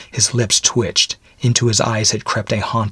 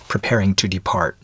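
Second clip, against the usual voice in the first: ordinary delivery, nothing marked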